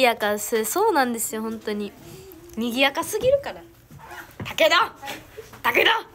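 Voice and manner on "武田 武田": sharp call across the room. Real, not acted. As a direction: call her name to stop her. Calling out to teasing member.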